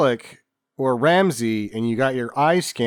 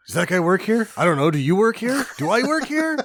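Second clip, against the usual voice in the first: higher-pitched mock bewilderment; questions climbing higher and higher; clueless employee; 7 semitones higher than usual. Co-host playing confused fake employees, in mock bewilderment.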